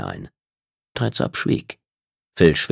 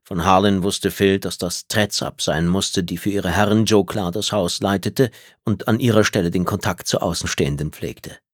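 plain reading voice

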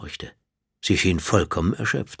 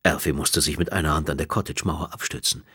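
plain reading voice

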